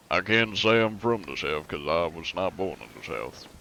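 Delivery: put-on Southern American drawl. Shown as a demonstration. Streamer explaining they weren't born there, Southern drawl. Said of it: "I can do the Southern drawl".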